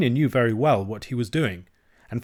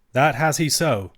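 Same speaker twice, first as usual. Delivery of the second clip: higher, louder emphatic answer; crying out; 4 semitones higher, 5 dB louder than usual. A narrator's reading. Alan answers the lass about the lad's friends, crying out. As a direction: insist on it with force. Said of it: "cried Alan".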